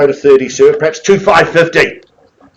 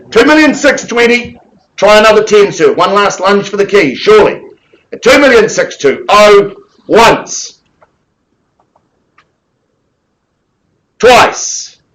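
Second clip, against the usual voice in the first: auctioneer calling; 7 semitones higher, 4 dB louder than usual. Real, not acted. Pushing for a higher bid.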